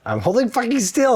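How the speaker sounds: high-pitched, exasperated retort; exasperated protest. Real, not acted. Man being measured answers accusation, exasperated.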